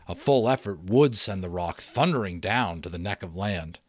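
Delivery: ordinary delivery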